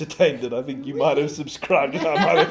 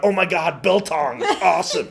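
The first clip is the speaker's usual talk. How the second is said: put-on failed New York accent, higher and louder; bad New York accent; 7 semitones higher, 6 dB louder than usual. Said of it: "that totally wasn't a New York accent".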